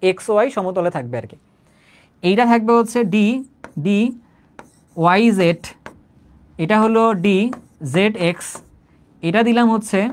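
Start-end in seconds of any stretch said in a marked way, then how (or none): 3.9-8.5 drawn out, letter by letter; slow dictation. Shown as a demonstration. Teacher slowly dictating d orbital names to students. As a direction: spell the names out for note-taking.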